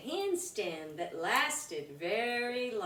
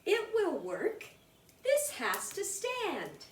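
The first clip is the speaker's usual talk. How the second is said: put-on high, eager boy voice; boy sure of his plan; 10 semitones higher than usual. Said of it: "Chad came up with a plan".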